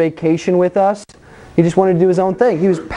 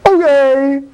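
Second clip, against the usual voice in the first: passive Adam agreeing; 6 semitones higher, 3 dB louder than usual. Retold or imitated. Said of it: "And he goes".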